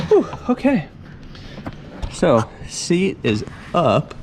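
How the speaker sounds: out of breath after lifting; puffing after heavy lifting; carrying heavy load. Real, not acted. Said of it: "This seat weighs a ton".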